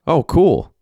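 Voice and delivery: high-pitched, enthusiastic reaction; excited exclamation. Acted. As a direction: exclaim it with keen interest. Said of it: "Derek exclaimed".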